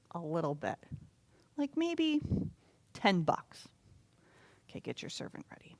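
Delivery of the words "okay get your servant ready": quick whispered aside. Real, not acted. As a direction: whisper it fast so only helpers hear. Aside to the children.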